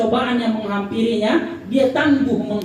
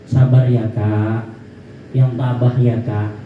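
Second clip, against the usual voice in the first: gentle comfort; 11 semitones lower than usual. Shown as a demonstration. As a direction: say it gently, like a hand on the shoulder. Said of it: "Kalau begini caranya".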